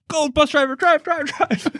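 a high, frantic push to get the bus moving; urging the driver. Retold or imitated. LeBron pictured ordering the bus away from coach, high mock panic.

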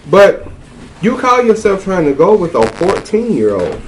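normal talking voice

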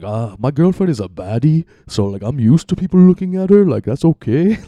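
high, loud put-on accent, mimicking him; mock African accent. Quoting the castmate about his girlfriend, mock African accent. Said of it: "I feel like I'm doing a pizza guy voice for an African here".

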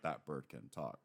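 quietly stunned, lightly voiced Luffy; amazed Luffy. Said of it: "Luffy over and over is like".

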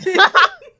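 high squealing laugh; real giggling fit; burst of laughter. Real, not acted. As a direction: let the laugh break out. Both laughing.

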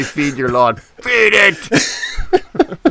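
giggling Scots imitation; laughing mock slogan. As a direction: laugh through the Scots guy's line. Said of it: "It wasn't enough to say".

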